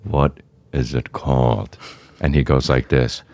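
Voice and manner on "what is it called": low, soft and slow; grave question. Retold or imitated. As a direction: ask slowly, braced for bad news. Dreading the documentary title.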